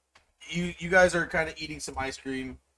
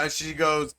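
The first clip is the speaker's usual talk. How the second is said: usual speaking voice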